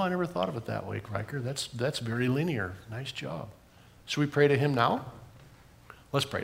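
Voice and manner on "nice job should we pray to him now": usual speaking voice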